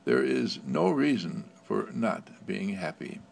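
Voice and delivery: ordinary talk, nothing marked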